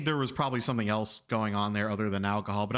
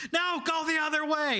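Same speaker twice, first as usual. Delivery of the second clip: high-pitched bossy order; exasperated passenger. Retold or imitated. Passenger contradicting her driver mid-turn, high exasperated imitation.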